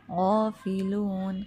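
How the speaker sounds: slow chanted "غافلون" with full, heavy gh; heavy ghain. Shown as a demonstration. Teacher modelling "غافلون", heavy ghain, slow chant. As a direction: raise the tongue root for "غافلون", vowels held. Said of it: "غائن جو ہے وہ پر حرف ہے".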